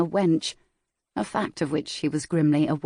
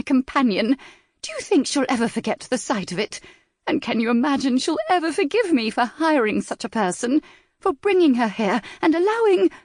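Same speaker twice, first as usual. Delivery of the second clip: higher, rising hysterical complaint; distraught outrage; 8 semitones higher, 3 dB louder than usual. A narrator's reading. Mother rails at the hosts over her daughter's humiliation, climbing higher.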